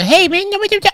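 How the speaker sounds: high mock baby talk; lightly imitating baby talk. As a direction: put on a squeaky baby voice for a second. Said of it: "speak to me in the baby voice".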